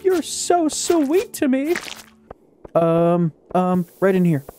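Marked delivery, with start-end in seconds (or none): 0.0-1.7 high, slow, coy put-on voice, drawn out; mock flattered. Gamer thanks the game for loot, high coy mock swoon. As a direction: swoon over the gift like a blushing admirer.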